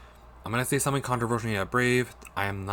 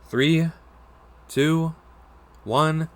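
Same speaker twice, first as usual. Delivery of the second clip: excited countdown; 6 semitones higher, 6 dB louder, much slower than usual. Real, not acted. Countdown before bite.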